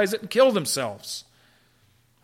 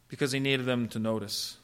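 normal talking voice

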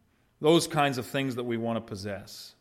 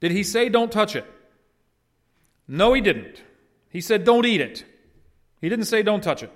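emphatic; 8 semitones higher, 7 dB louder than usual. Real, not acted. Stressing God's exact words.

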